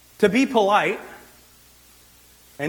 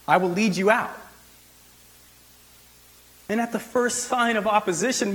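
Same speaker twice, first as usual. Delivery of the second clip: ordinary delivery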